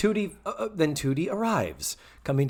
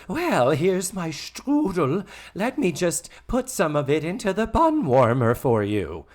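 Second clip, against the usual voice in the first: Mrs. Garrett imitation; 4 semitones higher, 4 dB louder than usual. Host acting out Mrs. Garrett offering her strudel, higher mock voice. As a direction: play her up, higher and stretched.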